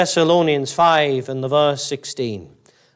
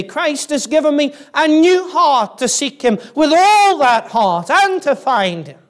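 building sermon fervour; 8 semitones higher than usual. Real, not acted. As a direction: preach it higher and higher with fervour. Preacher builds to full force.

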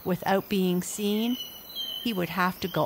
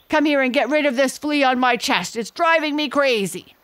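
loud, higher itchy grumbling; exasperated gorilla; 8 semitones higher, 6 dB louder than usual. A narrator's reading. Gorilla calls his monkeys, loud and exasperated. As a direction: call the monkeys, fed up.

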